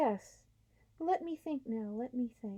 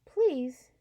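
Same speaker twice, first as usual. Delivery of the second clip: breathless; 4 semitones higher, 8 dB louder than usual. A narrator's reading. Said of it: "in a rather breathless voice".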